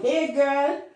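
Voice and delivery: playful Trinidadian phone greeting; Trini accent. Shown as a demonstration. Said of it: "With a little Trini accent on it".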